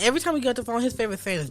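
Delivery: ordinary delivery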